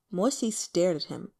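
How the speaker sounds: usual speaking voice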